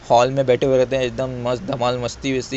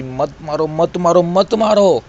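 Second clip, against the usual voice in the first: acted cries climbing higher and louder with each plea; screaming plea; 6 semitones higher, 5 dB louder, slower than usual. Victims begging their attacker for their lives, screaming. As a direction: start near normal, build to a high scream. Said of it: "वो लोग चिल्ला रहे थे, चीख रहे थे".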